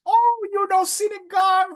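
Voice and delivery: imitating older women, high, slow and thrilled; delighted squeal. Imitating the fishing women thrilled he knows their soup, high delighted squeal. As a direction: squeal it out like an auntie who's just been delighted. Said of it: "They were so happy that I knew about their soup".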